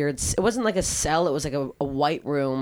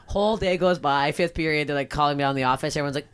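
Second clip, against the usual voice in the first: ordinary delivery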